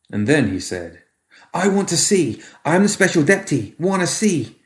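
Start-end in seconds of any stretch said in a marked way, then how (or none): 1.5-4.6 put-on high, pushy little boy; a small boy insisting he wants to see; demanding child. Boy demanding to see the papers, high and pushy.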